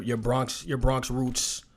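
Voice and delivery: ordinary delivery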